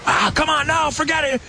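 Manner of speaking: high mock quarrel; high mock argument over the radio; arguing radio voice. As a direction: play two guys bickering. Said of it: "They're fighting".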